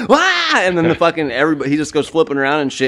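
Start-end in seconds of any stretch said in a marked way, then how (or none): 0.1-0.3 loud mock wail; comic imitation of a startled cry; imitated yell. Imitating the man as the cat attacks, mock yell. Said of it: "You hear him go".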